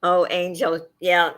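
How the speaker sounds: ordinary talk, nothing marked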